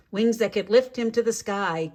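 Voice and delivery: usual speaking voice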